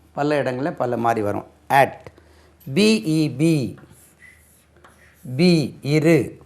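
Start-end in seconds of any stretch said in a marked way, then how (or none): none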